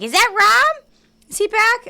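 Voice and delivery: Ophelia voice. Pretend. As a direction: squeal it with hopeful excitement. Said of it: "Ophelia's like".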